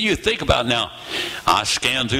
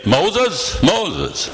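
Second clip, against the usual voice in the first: voiced, higher call of the name; God calling out; 6 semitones higher than usual. God calling Moses by name, raised voiced call. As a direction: call it twice, each falling away. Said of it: "Or did he say".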